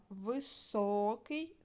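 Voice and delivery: високий drawn out slowly on СО; slow виСОкий, stressed syllable stretched; stress on middle syllable. Shown as a demonstration. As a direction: lean long on the о in високий. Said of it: "поставте наголос".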